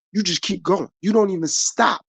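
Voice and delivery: ordinary delivery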